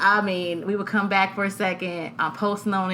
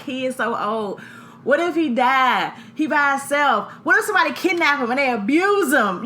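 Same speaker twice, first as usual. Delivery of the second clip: higher, louder worried rush of thoughts; retold panic; 6 semitones higher, 4 dB louder than usual. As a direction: voice the fear as it spirals. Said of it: "other minute I'm like".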